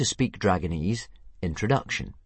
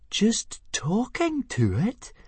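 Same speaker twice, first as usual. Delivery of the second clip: very high, slow, incredulous echo; drawn-out, high-pitched disbelief; astonished gasp; much slower than usual. A narrator's reading. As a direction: gasp it back in utter astonishment. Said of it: "gasped Fishlegs in astonishment".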